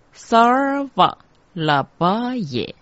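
high, measured mantra chant; mantra recitation. Shown as a demonstration. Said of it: "第一个字萨念成萨尔".

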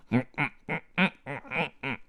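high muffled munching hums; little chewing "mm" noises; mouth-full mumbling. A narrator's reading. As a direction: hum through a stuffed mouth. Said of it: "贝利奥嘴里塞得满满的".

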